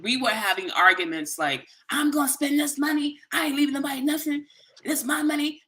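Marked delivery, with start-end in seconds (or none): 1.9-5.6 high, defiant imitation of mom insisting on spending; mother's defiant voice. Daughter mimicking her mother during their inheritance argument, high and defiant. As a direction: play the stubborn mother refusing to share.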